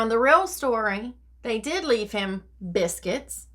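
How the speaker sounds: plain reading voice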